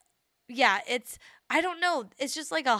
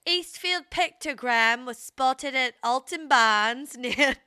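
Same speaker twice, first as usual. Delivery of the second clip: slow, louder put-on British accent; mock British accent; 3 semitones higher, 8 dB louder, slower than usual. Co-host parodies the British place names, hammed-up British accent. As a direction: exaggerate a British accent, breaking into laughter. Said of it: "It all sounds very British".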